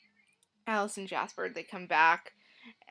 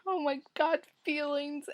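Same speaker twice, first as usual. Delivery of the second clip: higher-pitched gush of feeling; high overwhelmed squeal; 7 semitones higher than usual. Retold or imitated. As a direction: fan overcome by the ending song, high emotional gush. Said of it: "I'm just like".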